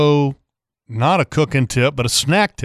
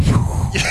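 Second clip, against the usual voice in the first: sharp voiceless whoosh of a whale surfacing; breathy whale spout noise; imitated whale blow. Imitates whales surfacing beside boat.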